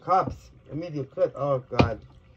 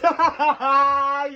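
loud laugh; 5 semitones higher, 5 dB louder than usual. Real, not acted. Laughs at her own pun.

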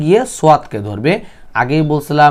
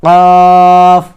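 slow, drawn-out verse recitation; Quran recitation; 3 semitones higher, 6 dB louder, much slower than usual. Shown as a demonstration. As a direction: recite slowly, stretching the long vowels and the final syllable.

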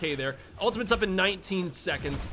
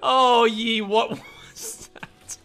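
laughing in disbelief; 6 semitones higher, 4 dB louder, much slower than usual. Real, not acted. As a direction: laugh then dismayed whisper. Teammate's misplay.

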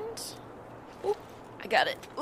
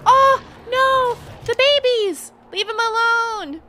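alarmed cry; 14 semitones higher, 10 dB louder than usual. Real, not acted. Sees creatures attacked in game.

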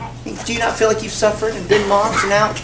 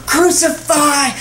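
higher, drawn-out mob cry; crowd yell; 5 semitones higher, 3 dB louder, slower than usual. Acted. The crowd answers Pilate, yelling for an execution. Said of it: "The crowd still yells".

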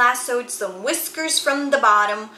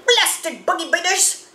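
higher, cussing cry at the bugs; gruff shout; 8 semitones higher than usual. A narrator's reading. Uncle Tex in a swarm, shouting curses. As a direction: spit the curse out. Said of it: "shouted Uncle Tex".

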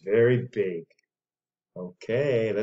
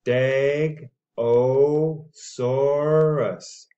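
slowly drawn-out name; 3 semitones higher, 4 dB louder, much slower than usual. Shown as a demonstration. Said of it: "slow model of name".

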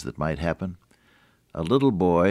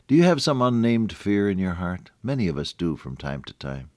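ordinary delivery

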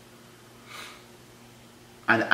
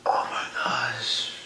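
slow, breathless whisper of embarrassment; 8 dB quieter, much slower than usual. Real, not acted. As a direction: hush it out, slowly, to yourself. Embarrassed after screaming.

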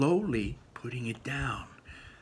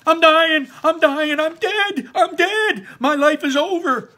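very high, loud panicked scream; 11 dB louder than usual. Acted. Ian screaming while dangling on the rope. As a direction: shriek it like he is falling. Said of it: "screamed Ian".

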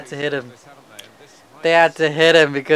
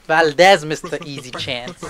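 ordinary talk

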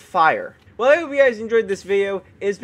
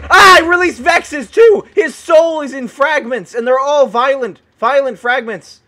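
raised, loud panicked exclaiming; alarmed shout; 4 semitones higher, 8 dB louder than usual. Real, not acted. Gamer who just released Vexes from a clown, alarmed shout. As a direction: cry out in alarm, easing slightly.

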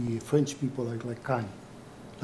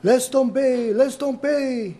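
loud, high French workman's yell; shouted command, last words drawn out; 11 semitones higher, 8 dB louder, slower than usual. Acted. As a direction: call it out across the factory floor. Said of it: "some French man yell out".

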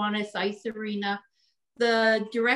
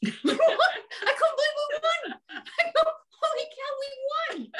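high, giddy laughter through the words; laughing with joy. Real, not acted. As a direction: laugh it out, still amazed at the win. Thrilled recalling the tournament win.

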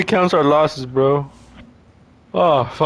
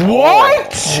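high, loud rising what; shocked what; 5 dB louder than usual. Real, not acted. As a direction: cry out in disbelief. Surprise in game.

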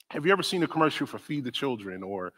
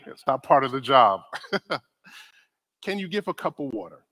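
usual speaking voice

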